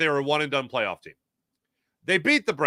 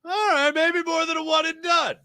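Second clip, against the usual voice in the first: mock grudging concession.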